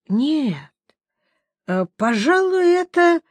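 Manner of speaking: grimacing as if at a bad smell; rising pitch on a disgusted grimace; wincing. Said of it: "поморщился краснолюд".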